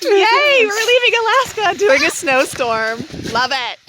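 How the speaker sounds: high, loud mock excitement; sarcastic cheer. Real, not acted. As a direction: cheer it with heavy irony. Sarcastic cheer about snowstorm.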